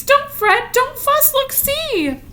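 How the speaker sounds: a bright little mouse piping up; squeaky mouse. Acted. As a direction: cheer up the bear in a tiny squeaky voice. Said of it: "Mouse squeaks".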